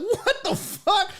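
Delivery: high, slow, laughing disbelief; stunned disbelief. Retold or imitated. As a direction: drag it out, baffled and amused. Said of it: "I'm like".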